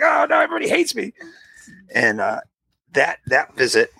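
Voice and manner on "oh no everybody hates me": high, quick wail; frantic, high-pitched comic lament; mock despair. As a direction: act out a kid's hammed-up misery. Acted child's despair, for laughs.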